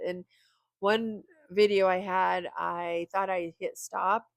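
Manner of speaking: normal talking voice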